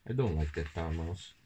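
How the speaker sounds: ordinary delivery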